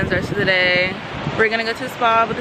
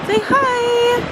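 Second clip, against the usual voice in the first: excited call; 14 semitones higher, 6 dB louder than usual. Real, not acted. Calling partner to greet camera.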